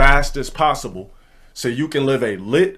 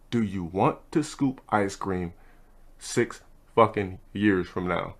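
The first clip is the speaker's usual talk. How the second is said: ordinary talk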